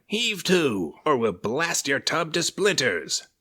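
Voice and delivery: high, loud pirate bellow; pirate captain roaring at the fleeing ship. A narrator's reading. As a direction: roar across the water. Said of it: "roared the pirate captain".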